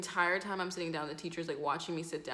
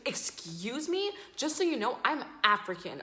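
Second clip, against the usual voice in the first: offended retort; 5 semitones higher, 3 dB louder than usual. Retold or imitated. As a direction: mimic her taking offence, pitched up. Acted girl retorts, offended.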